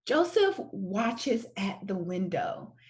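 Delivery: ordinary delivery, nothing marked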